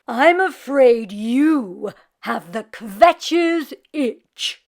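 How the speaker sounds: slow, loud, solemn diagnosis; grave old rabbi voice. Acted. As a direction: deliver bad news like an old rabbi.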